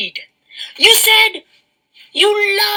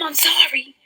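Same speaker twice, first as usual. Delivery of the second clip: unvoiced breathy "sorry", half-joking; mock whispered apology; 6 dB quieter than usual. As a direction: whisper the apology with fake remorse.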